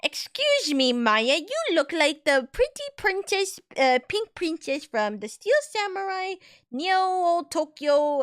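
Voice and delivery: high, oily pickup line; slimy flirt chatting her up; sleazy guy voice. Retold or imitated. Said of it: "the guy who tried to be like".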